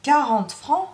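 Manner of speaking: high, incredulous question rising at the end; exclaiming. Acted. Madame hears the servant's wage demand, exclaiming in disbelief. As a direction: throw it back as an outraged query. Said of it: "s'exclama madame".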